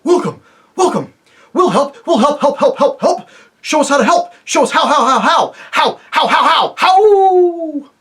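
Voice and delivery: loud, high, eager yelps building to a shout; joyful barking dogs. Acted. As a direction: bounce every word like happy dogs. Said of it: "all saying at once".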